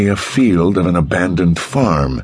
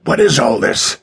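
raised, higher-pitched demand; shouted; 7 semitones higher than usual. A narrator's reading. King demanding answers from the buried man, shouted. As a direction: call out with a restrained shout. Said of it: "King shouted".

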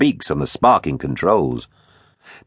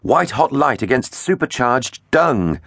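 ordinary delivery, nothing marked

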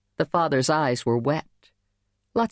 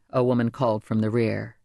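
plain reading voice

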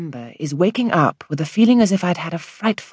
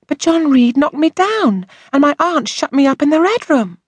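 put-on upset young girl; 12 semitones higher than usual. A narrator's reading. Girl telling the doctor how she was mistreated, aggrieved child voice. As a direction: plead your grievance as a hurt child.

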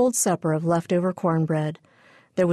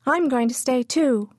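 put-on higher small-child voice echoing her sister; little sister echoing; 6 semitones higher than usual. A narrator's reading. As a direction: copy big sister like a toddler. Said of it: "Pidge echoed".

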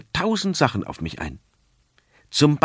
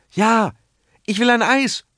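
put-on high eager child calling out, stress on "will"; little boy begging, "will" rising high; eager child's call; 11 semitones higher, 4 dB louder than usual. A narrator's reading. Boy calls to his parents for ice cream, eager and high. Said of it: "ruft Pepe da".

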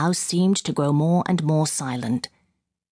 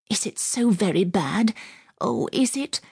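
higher-pitched, imploring question; anxious pleading; 6 semitones higher than usual. A narrator's reading. Frightened woman begging the officer for news, anxious pleading. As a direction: plead lightly, pitch climbing on the second question.

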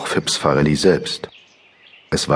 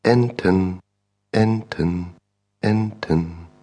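distant echo dying away; fading echo; 3 semitones higher, 3 dB quieter, much slower than usual. Acted. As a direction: echo getting softer each time. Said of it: "leiser werdend antwortet".

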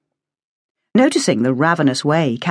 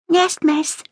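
put-on higher, timid little girl voice; small girl; 13 semitones higher than usual. A narrator's reading. Evacuee girl answering the teacher in a small voice. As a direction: play a shy young girl answering.

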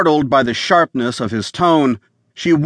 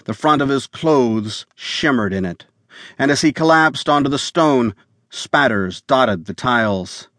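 ordinary delivery, nothing marked

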